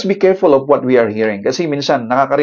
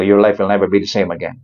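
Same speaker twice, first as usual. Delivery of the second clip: usual speaking voice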